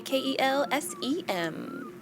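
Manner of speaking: ordinary talk, nothing marked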